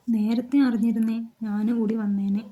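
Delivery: usual speaking voice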